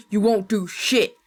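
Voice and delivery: slow, loud monstrous threat; menacing threat. Acted. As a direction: snarl it at her. Grandpa threatens grandma.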